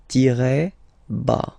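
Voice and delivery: "Tiret bas" slow, tee-reh bah; slow French tiret bas. Shown as a demonstration. Teacher naming the underscore in French. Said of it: "we call it tiret bas".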